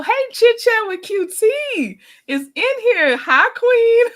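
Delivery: light up as a friend walks in; excited high greeting. Real, not acted. Host excitedly greets a favorite viewer in chat, high and loud.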